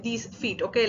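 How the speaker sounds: usual speaking voice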